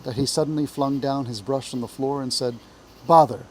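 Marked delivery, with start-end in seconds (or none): 3.1-3.4 loud, sudden burst of annoyance; exasperated outburst. A narrator's reading. Mole fed up with spring cleaning, exasperated outburst. Mole throws down brush in frustration.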